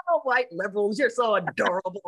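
lightly patronizing gush; a touch of sarcastic sweetness; mock-adoring. Repeats her mocking line to audience.

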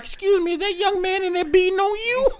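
very high-pitched prim neighbor voice; concerned neighbor. Acted. Neighbor checking on a limping woman, high concerned voice. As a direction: pipe up high as a worried neighbor. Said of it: "The neighbors would be concerned".

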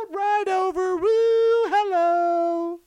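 very high, drawn-out singsong; mock carefree greeting. Balloon drifting over the country unbothered, mock carefree greeting. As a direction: float it out high like something drifting by.

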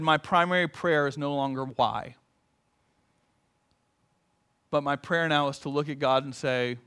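soft, low voice for her new prayer; hushed, searching questions; quiet retelling. Retold or imitated. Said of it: "said very quietly".

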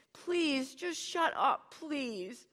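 high, tearful begging; begging girl. Acted. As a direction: beg him to stop, close to tears. Cody begs her father.

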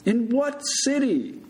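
slow, high shouted question. A narrator's reading. Big Bird tests patient's orientation, shouting. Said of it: "Mister Stoltz shouted".

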